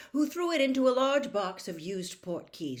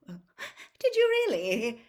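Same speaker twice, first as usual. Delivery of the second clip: higher, slow, louder nervous question; 5 semitones higher, 7 dB louder, much slower than usual. Acted. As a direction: feign surprise to hide worry. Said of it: "said Mister Weasley nervously".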